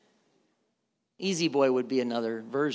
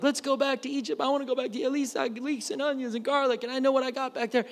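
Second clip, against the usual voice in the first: much higher, pleading people wanting Egypt; whining Israelites; 9 semitones higher, faster than usual. Retold or imitated. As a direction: whine like people longing for Egypt. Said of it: "They're like".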